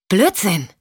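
"Blödsinn!" snapped out, curt and dismissive; "Blödsinn!" as a short, hard, scornful snap; scornful retort. Acted. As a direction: spit out "Blödsinn!" in disbelief. Michelle rejects Sean's claim.

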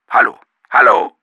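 loud impatient calling down the line. Acted. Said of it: "rührte es ungeduldig aus der Leitung".

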